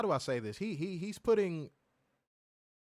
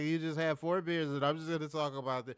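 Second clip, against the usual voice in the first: put-on drunk voice. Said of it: "talk like this to the people".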